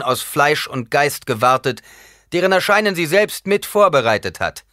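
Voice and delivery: loud, high-pitched public proclamation. A narrator's reading. Paul addressing his comrades about the Reverend Mother, loud. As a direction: call it out to everyone present. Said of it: "Lauter sagte Paul".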